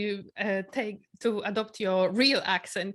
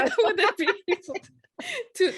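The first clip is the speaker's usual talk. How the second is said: laughing through the words, high and loud; real laughter; 12 semitones higher, 7 dB louder, much faster than usual. Real, not acted. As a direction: blurt it out fast while cracking up. Laughing over the accent request.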